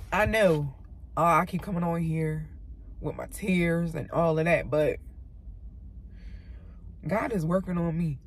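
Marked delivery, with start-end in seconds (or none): none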